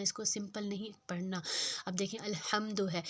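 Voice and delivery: normal talking voice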